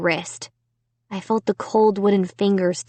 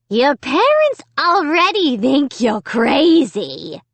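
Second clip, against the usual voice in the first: slow, higher cold taunting; harsh dummy rasp; 6 semitones higher, 4 dB louder, slower than usual. A narrator's reading. Dummy taunting Amy about her parents, a cold rasp. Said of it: "harsh, cold, whispery voice".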